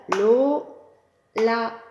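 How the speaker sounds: Lo-la in two loud separate beats; syllables tapped out. Shown as a demonstration. Teacher to class, chanting the name in beats. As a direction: clap each syllable out loud. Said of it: "Tapons les syllabes".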